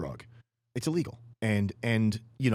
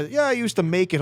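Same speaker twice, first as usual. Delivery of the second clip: higher, louder cocky ex-stockbroker voice; imitating a dealer; 10 semitones higher, 8 dB louder than usual. Mocking the former stockbroker dealer, higher and louder. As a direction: play the smug guy who cooked it himself. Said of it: "It's like, this guy".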